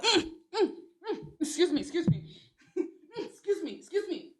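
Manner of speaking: high, laughing, squeamish excuse me's; mock disgusted excuse me. Reacting to a man's smell, mock disgusted squeal. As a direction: pull away from the smell with a laugh.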